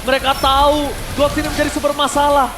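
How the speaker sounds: normal talking voice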